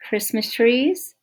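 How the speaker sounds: usual speaking voice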